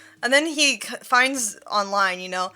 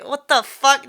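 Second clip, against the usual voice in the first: exasperated outburst; 4 semitones higher, 7 dB louder than usual. Real, not acted. Venting about the man.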